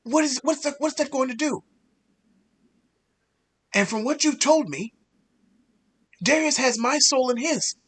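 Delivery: ordinary talk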